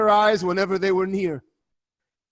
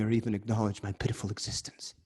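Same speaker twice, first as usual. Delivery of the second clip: ordinary talk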